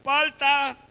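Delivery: high, sustained hawker's cry; shouted vendor call. Acted. Selling avocados from the Subaru, high street cry. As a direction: call out to passing customers. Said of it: "Y estaba gritando".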